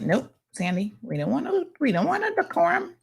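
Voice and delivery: high-pitched mock protest; put-on high objecting voice; mimicking Brandon. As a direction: imitate someone refusing, voice pushed way up high. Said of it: "Brandon's like".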